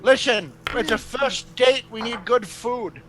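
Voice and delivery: lightly voiced demanding figure; Sergio. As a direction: call out an order, drawn out. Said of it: "And then you just hear Sergio go".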